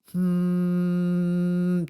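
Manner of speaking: high held hum; steady hum. Shown as a demonstration. Voice teacher demonstrating a sustained hum. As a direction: hold one long even hum. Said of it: "starting off with a hum".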